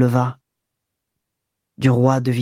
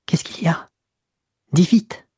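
stammering; 4 semitones higher than usual. Acted. Woman stammers to her lover, frightened by his news, whispering then urgent. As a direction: breathe it out in fear, then press for the answer. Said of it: "elle balbutia".

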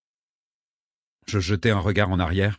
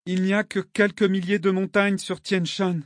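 put-on higher-pitched girl's voice, subdued and miserable; monotone, unhappy; 9 semitones higher than usual. A narrator's reading. Girl tells her friend about the mountains, monotone and unhappy. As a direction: say it evenly, with unhappiness held in. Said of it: "dit-elle d'une voix monotone et malheureuse".

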